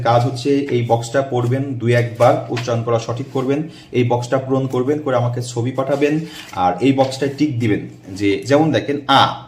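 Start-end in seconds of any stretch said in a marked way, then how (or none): none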